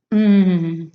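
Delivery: loud savoring hum; delighted mmm. A narrator's reading. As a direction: hum like it's delicious. Tasting the beans.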